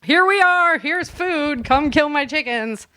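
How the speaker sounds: usual speaking voice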